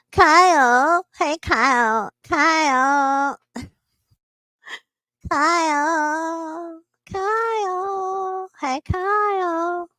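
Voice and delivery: high, drawn-out silly call; silly put-on voice. Host calling Kyle for fun, silly high voice. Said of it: "That's a great voice".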